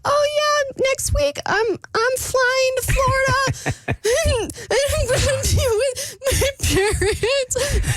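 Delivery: high, tearful voice breaking into sobs; crying while talking. Retold or imitated. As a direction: fight back tears as you answer. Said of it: "I start crying".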